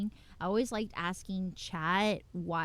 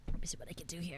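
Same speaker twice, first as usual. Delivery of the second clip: whispering; 3 semitones lower, 5 dB quieter, much faster than usual. Real, not acted. Muttering to self while drawing.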